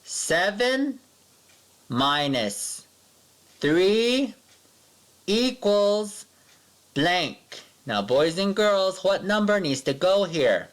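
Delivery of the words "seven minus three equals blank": high, spaced words with a pause between each; read-along equation. Shown as a demonstration. Teacher reads the subtraction with the class, word by word. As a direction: lead the class through it word by word. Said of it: "Let's read it again".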